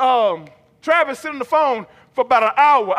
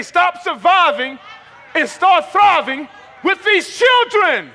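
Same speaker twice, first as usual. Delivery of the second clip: high, loud, drawn-out rallying shout; fired-up shout to rouse a congregation; angry, upset preaching; 5 semitones higher, 4 dB louder, much slower than usual. Shown as a demonstration. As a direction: preach it slow and angry, voice raised. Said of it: "get angry and get upset and say".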